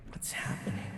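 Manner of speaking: a breathy private whisper; whispered thought. Acted. Alone after escaping her room, whispering to himself. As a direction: whisper it to yourself, bewildered.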